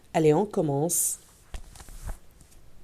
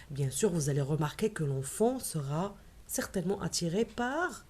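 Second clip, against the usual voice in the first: quiet; 10 dB quieter than usual. Real, not acted. Quiet section.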